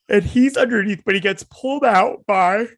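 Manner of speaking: high, stretched words mid-yawn; talking through a yawn. Real, not acted. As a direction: keep talking as a real yawn takes over. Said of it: "sorry for the yawning".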